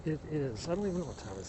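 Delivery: ordinary talk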